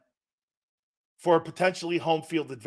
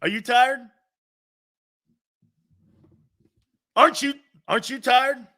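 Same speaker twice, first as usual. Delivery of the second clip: mocking disbelief; 7 semitones higher, 4 dB louder than usual. Mocking whoever complains about work.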